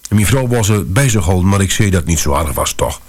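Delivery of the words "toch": plain reading voice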